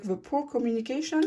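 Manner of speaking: mock-posh English on "the poor communication"; playful fake English accent; deliberate English accent. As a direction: slip into a put-on English accent on purpose. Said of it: "C'est lui mon accent. Je fais exprès".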